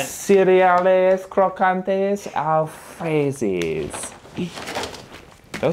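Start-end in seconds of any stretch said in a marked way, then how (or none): 0.0-3.9 mock French accent.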